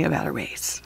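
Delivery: plain reading voice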